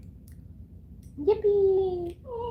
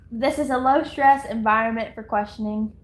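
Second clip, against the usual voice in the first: ordinary talk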